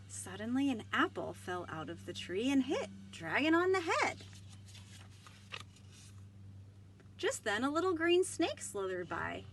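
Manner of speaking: lively storybook read-aloud with voiced characters; reading to children. Librarian reading Dragon aloud to children, animated.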